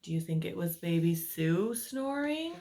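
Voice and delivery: plain reading voice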